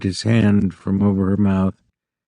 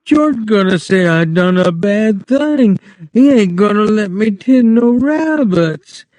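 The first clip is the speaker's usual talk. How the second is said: frightened pleading; 10 semitones higher than usual. A narrator's reading.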